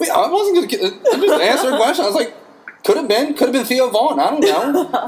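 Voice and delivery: high, laughing protest. Real, not acted. Bartender laughing as he defends his question, high laughing. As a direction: laugh through the excuse.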